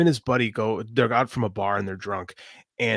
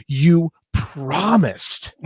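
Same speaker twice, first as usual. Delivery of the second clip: drawn-out, raised, louder cry of betrayal; retold tearful accusation; 4 semitones higher, 6 dB louder, much slower than usual. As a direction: stretch each word like a wounded wife. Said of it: "she starts crying, and she goes".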